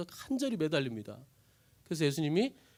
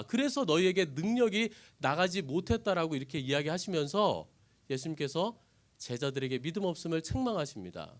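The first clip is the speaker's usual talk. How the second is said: ordinary delivery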